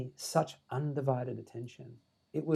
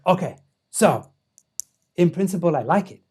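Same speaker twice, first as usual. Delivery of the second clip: badly imitated accent, louder and measured; Dalai Lama; 4 semitones higher, 8 dB louder than usual. Giving a verdict on the idea, bad Dalai Lama accent. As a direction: attempt his accent, weighing each phrase. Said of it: "I'm doing a terrible Dalai Lama accent".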